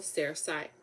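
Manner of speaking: ordinary delivery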